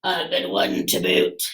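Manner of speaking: low, soft gruff crew echo; crew agreeing. Acted. Pirate crew echoes their captain, low gruff agreement. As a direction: mutter it like the whole crew. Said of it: "the others agreed".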